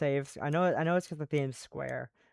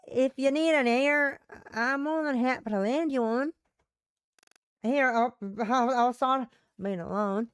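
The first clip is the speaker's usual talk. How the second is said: Southern woman; 6 semitones higher than usual. Acted. Offering a listening ear, in a Southern accent. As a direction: friendly offer in a female Southern voice. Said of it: "one female Western Southern voice".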